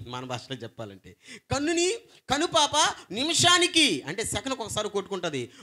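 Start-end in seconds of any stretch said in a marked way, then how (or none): 1.5-4.0 high, loud burst of preaching; preaching peak. Real, not acted. Preacher stirring the congregation, high and loud. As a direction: lift the phrase high and ring it out.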